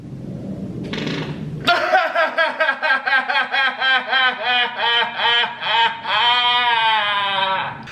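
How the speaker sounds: real laughter, long burst. Real, not acted. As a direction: laugh helplessly. Long laugh after chaos.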